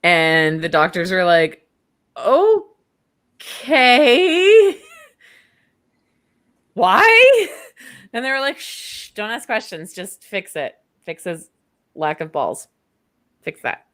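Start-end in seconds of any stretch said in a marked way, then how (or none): 2.2-7.5 high, rising, drawn-out doubt; mock bafflement. Acts out baffled doctors. 8.6-13.8 soft, secretive orders; hushed insistence. Retold or imitated. Acts out followers hushing the doctors.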